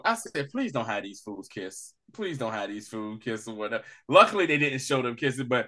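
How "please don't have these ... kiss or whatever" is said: hushed, lowered begging; soft worried plea, pitched low; low quiet plea. Retold or imitated. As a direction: plead under your breath, low. Pleading to the TV.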